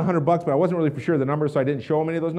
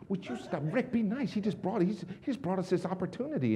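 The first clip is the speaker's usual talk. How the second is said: soft, higher voice of a chiding wife; hushed scolding; 3 semitones higher, 10 dB quieter than usual. Retold or imitated. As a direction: scold your husband under your breath. Wife scolding husband aside.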